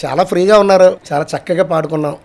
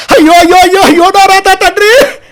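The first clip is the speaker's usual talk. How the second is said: much higher, louder, slower wailing lament; Suryakantham impression; 13 semitones higher, 10 dB louder than usual. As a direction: wail like a scolding old film matriarch. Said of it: "అని ఆవిడ మాట్లాడే మాట".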